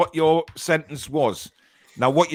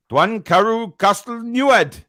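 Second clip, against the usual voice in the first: slow Welsh accent, louder; 5 semitones higher, 6 dB louder, slower than usual. Shown as a demonstration. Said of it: "Here's some proper Welsh".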